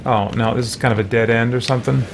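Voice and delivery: ordinary talk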